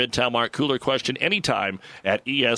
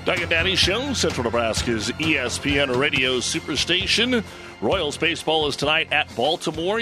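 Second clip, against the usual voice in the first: radio announcer; 5 semitones higher than usual. Acted. Host station ID announcement.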